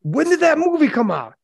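high, drawn-out incredulous question; can't believe that year; astonished disbelief. Real, not acted. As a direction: blurt it in stunned surprise, pitch up. Stunned by the birth year.

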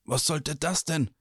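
asking in a daze after the slap; dazed. A narrator's reading. Quinn, just slapped by Torvald, asks dazed. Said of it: "fragte er benommen".

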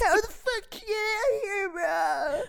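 annoying character voice. Acted. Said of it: "he got really annoying really fast".

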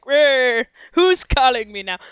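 put-on loud growling old miser; grumpy old Scrooge. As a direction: growl it like a woken old grouch. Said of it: "Ebenezer Scrooge with the fucking candle".

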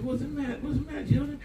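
mock concern. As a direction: coo at the fussing children. Pastor voices himself to crying kids.

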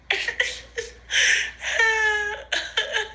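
high laughter verging on crying; tearful laughing fit. Real, not acted. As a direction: laugh helplessly till it sounds like crying. Uncontrollable laughing, close to tears.

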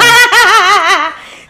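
loud high laugh breaking out; burst of laughter. Real, not acted. Wife bursting out laughing at husband's remark, loud laugh.

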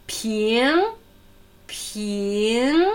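Ping stretched out and gliding upward; isolated Ping with a steady rise; rising second tone. Shown as a demonstration. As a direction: draw Ping upward like a question. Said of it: "Zweite aufsteigende".

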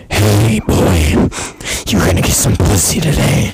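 low gravelly-free superhero growl, loud; Batman voice. Retold or imitated. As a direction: talk like Batman hyping you up. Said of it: "he sounds like Batman".

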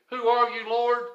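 high, loud, startled question; alarmed cry. Acted. Knocked down by the light, Saul asking, alarmed cry.

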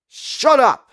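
high angry yell; shouting. Acted. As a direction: snap it out sharp and high. Woman yelling in alley.

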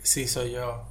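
small, subdued voice retelling an awkward answer; sheepish reply. Retold or imitated. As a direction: trail off quietly, embarrassed. Retelling his sheepish reply to her.

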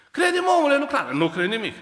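high, loud, pleading shout; exasperated plea. Acted. Preacher pleading with unbelieving Ahaz, loud. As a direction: yell it at the stubborn king.

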